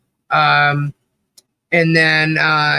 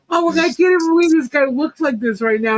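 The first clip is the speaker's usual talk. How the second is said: amazed; 8 semitones higher than usual. Retold or imitated. Amazed at the singer's looks.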